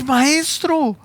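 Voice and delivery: high cry of Maestro; astonished recognition. Acted. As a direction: call out Maestro in wonder. Mary calls Jesus teacher.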